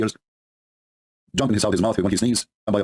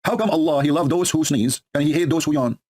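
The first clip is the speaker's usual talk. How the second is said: ordinary talk, nothing marked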